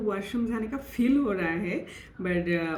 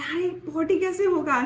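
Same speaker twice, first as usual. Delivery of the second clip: higher, incredulous inner protest; retold disbelief; 8 semitones higher, 4 dB louder than usual. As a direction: replay your baffled objection with raised pitch. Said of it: "मैं सोच रही हूँ". (हैरानी भरा विरोध)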